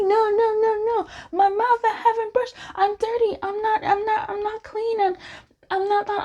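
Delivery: very high, whimpering mock plea; whiny protest. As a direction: squeal it like a flustered partner near tears. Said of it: "And he was like".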